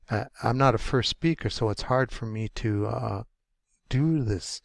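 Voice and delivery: normal talking voice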